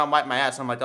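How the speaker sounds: ordinary talk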